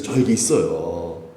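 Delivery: ordinary delivery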